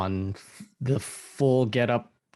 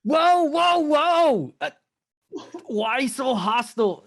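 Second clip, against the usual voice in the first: startled protest; 11 semitones higher than usual. Acted. Newcomer held at gunpoint, startled acted protest. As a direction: yelp it high, then ease off.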